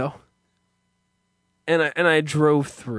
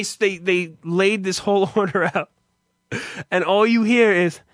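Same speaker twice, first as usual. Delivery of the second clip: ordinary talk, nothing marked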